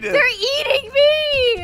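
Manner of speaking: high, drawn-out wail; hammed-up slow squeal of distress; mock agony. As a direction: wail as if being eaten alive, playfully. Eaten by spiders in game.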